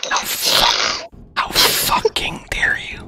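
hammed-up betrayed whisper-cry; overacted heartbreak, voice breaking; betrayed and heartbroken. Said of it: "So dramatic".

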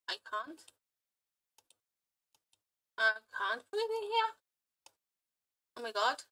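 ordinary talk, nothing marked

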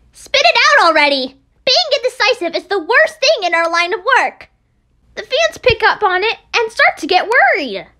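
loud, impatient reprimand; angry scolding. Acted. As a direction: snap at her to stop dodging. Idol berating bandmate in quarrel.

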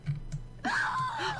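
sudden high squealing laugh; shrieking laugh. Real, not acted. Sim starts screaming in labor, shrieking laugh. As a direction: burst out in a squeal.

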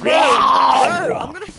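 panicked drawn-out shout. Real, not acted. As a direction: yell it out in panic.

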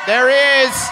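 shouted greeting to the crowd. Real, not acted. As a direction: call out to him across the room. Calling out to fan.

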